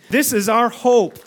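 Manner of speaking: high, loud, slow declaration; loud proclamation. Acted. Preacher calling the congregation to respond, loud and slow. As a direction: proclaim it to wake up the room. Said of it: "Let's hear a few more amens".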